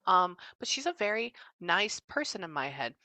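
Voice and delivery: normal talking voice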